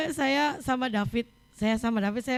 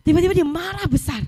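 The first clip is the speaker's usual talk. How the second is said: higher, louder burst of storytelling; dramatic retelling; 6 semitones higher, 5 dB louder, faster than usual. Retold or imitated. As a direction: tell the outburst with a sudden lift. Retells child's sudden big anger. (bercerita dengan nada tinggi dan dramatis)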